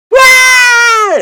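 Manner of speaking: very high, loud mock scream; Nessa's scream. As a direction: imitate a belated shriek at full voice. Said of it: "And she's, like, screaming".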